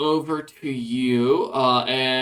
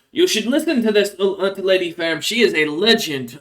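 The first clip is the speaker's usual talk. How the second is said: higher, eager praise; admiring rat folk; 6 semitones higher, 4 dB louder than usual. Acted. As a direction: talk her up like a devoted fan.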